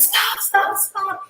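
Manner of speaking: very high, quick mock cries; urgent pleading imitation. Girls pulling two fighters apart, urgent. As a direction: imitate onlookers pleading to break it up.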